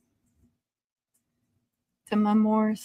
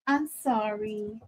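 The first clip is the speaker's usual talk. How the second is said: soft apology; 7 dB quieter, slower than usual. Real, not acted. Comforting frightened dog.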